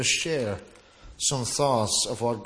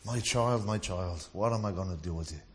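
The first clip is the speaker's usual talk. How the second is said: softer, weary sigh of a fed-up parent; a parent shaking their head at the mess, softer and quick at the end; mock exasperated parent, softer; 6 semitones lower, 7 dB quieter than usual. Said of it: "an exasperated parent that's like".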